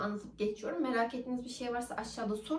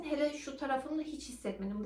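ordinary delivery

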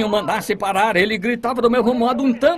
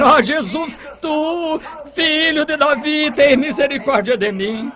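desperate cry; 6 semitones higher than usual. Retold or imitated. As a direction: cry out to Jesus for mercy. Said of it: "O desespero fez gritar".

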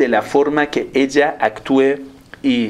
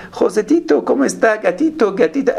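high, cooing mock sweet talk; flirty pet-name talk; 8 semitones higher than usual. Coworker flirting with pet names, high mock coo. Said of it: "Y otro dice".

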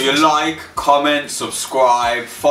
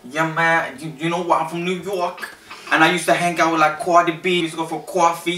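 higher-pitched mock New York accent; 6 semitones higher, faster than usual. New Yorker recalling hanging out with [name], mock New York accent. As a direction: play a Brooklyn local, pitched up. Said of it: "my next accent is New York".

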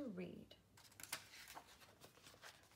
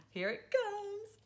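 high and slow; 4 dB louder, slower than usual. Pretend. Dread before nonsense.

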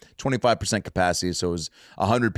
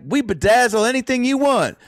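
put-on thick Houston accent; 13 semitones higher, 4 dB louder than usual. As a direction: boast as the Houston shop owner, in her accent. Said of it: "someone with a thick accent like that".

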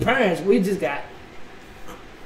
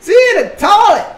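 incredulous shout; 13 semitones higher, 9 dB louder than usual. Real, not acted. Vlogger repeating what the clip shows, incredulous shout. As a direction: yell it in disbelief. Reacting to child in toilet clip.

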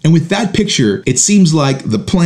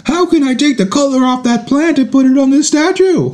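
put-on much higher whining kid voice; smart-aleck kid; 12 semitones higher than usual. Dad mimicking his son's pushy question in a high kid voice. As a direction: push the parent further, innocently. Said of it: "He says".

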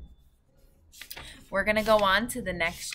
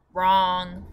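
loud storybook "wrong", not raised in pitch; crying out; 7 dB louder than usual. A narrator's reading. Giant correcting Sophie's guess, crying out. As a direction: call it out as the giant's emphatic correction. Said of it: "cried the giant".

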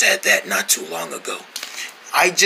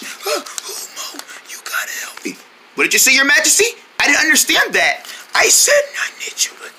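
breathy whisper with no voice left; lost voice; 4 semitones higher than usual. Acted. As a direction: whisper as if your voice is gone. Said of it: "I'm afraid you lost your voice".

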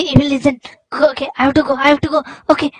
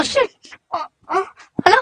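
normal talking voice

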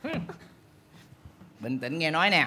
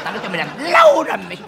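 scolding yell, louder and higher as it builds; furious yell; 11 semitones higher, 8 dB louder, faster than usual. Acted. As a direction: blow up at the worker, fast and loud. Bà bầu quát, lặp lại câu hỏi. (quát lớn, tăng dần lên đến đỉnh)

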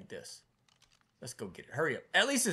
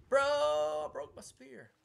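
drawn-out dismay; 9 dB louder, much slower than usual. Real, not acted. Dismay at broken spear.

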